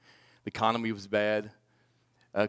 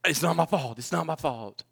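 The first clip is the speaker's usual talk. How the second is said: blowing up; 4 semitones higher than usual. Retold or imitated. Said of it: "I blew up at her".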